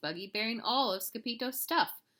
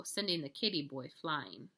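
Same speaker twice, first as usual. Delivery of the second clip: usual speaking voice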